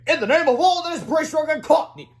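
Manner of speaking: high, loud heroic proclamation; commanding superhero. A narrator's reading. Superhero proclaims to the villain, loud and grand. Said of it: "said Counter Defense".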